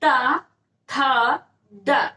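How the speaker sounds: टा, था, डा as loud retroflex syllables, था said "thaa" with curled tongue; sound demonstration. Shown as a demonstration. Teacher showing the tongue going up, टा, था, डा sounded out loudly. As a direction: punch out टा, था, डा one at a time. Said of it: "टावर में आप बोलोगे".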